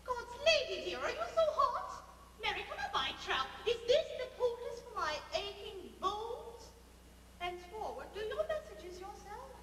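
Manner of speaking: high indignant scolding nurse; huffy old nurse. Acted. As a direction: snap back in offended huff.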